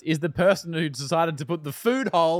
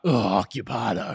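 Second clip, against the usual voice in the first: grumpy low groan; 4 semitones lower than usual. Retold or imitated. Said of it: "Wasn't like".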